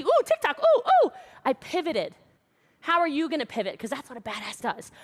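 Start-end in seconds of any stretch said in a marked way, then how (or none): 0.0-1.1 high, loud mock squeals of delight; hammed-up TikTok excitement. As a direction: squeal like a thrilled fan.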